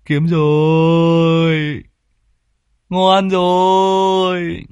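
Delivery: slow, drawn-out high gloating; greedy old cardsharp relishing the pot; gloating gambler. Acted. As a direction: savour the win under his breath. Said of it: "nghĩ bụng".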